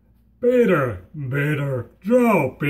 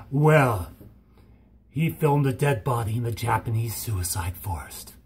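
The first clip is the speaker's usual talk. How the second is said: low grim voice; 9 semitones lower, 6 dB quieter than usual. Retold or imitated. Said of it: "it's like".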